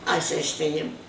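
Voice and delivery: ordinary talk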